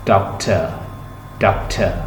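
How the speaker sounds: डॉक्टर with a weak final uh; schwa ending. Shown as a demonstration. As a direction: drop the last vowel to schwa. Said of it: "श्वा sound".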